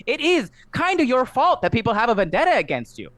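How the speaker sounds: a put-upon little gnome voice. Acted. Gnome behind the bar greeting a newcomer, high squeak.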